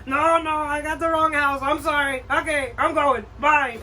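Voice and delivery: high, hurried retreat; sheepish exit. Acted. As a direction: slip away awkwardly. Collector retreats.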